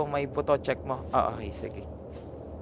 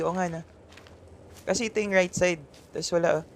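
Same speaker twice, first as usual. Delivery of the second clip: ordinary delivery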